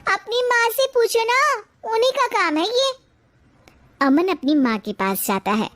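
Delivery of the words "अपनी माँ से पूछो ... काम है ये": sassy retort. Acted. Wife snaps back at husband.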